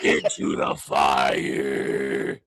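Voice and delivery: low, slow, croaking shout; hoarse singer parody. Pretend. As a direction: belt it out with a wrecked voice.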